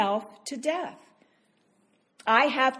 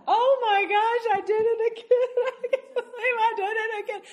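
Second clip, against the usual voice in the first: mock dismayed wail; 10 semitones higher, 4 dB louder than usual. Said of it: "we do that".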